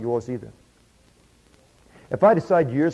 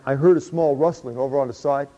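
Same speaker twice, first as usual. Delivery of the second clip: ordinary talk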